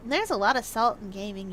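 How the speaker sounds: ordinary talk, nothing marked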